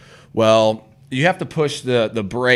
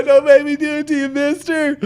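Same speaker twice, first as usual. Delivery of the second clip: mock scared; 5 dB louder than usual. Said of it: "Yeah, yeah".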